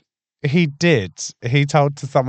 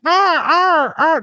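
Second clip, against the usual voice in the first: high screechy seagull squawk; seagull impression; 7 dB louder, much slower than usual. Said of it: "that's my seagull impression".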